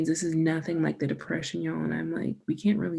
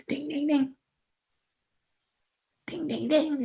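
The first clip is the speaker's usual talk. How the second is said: high sung ding ding; imitating the bell; 9 semitones higher than usual. Mimicking the dog's bell for the listener, high chiming dings. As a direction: chime it like a dog ringing a bell. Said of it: "He goes like".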